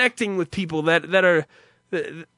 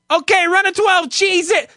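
high, loud yelled order across the kitchen; screamed kitchen order; 11 semitones higher, 6 dB louder than usual. Retold or imitated. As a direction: bark the order out over the grill noise. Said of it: "They would scream that".

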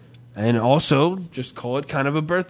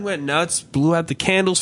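ordinary delivery

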